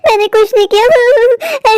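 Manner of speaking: high, drawn-out wail; wailing protest. Acted. Accused of stealing a match, woman wails her denial. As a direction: stretch the denial into a slow lament.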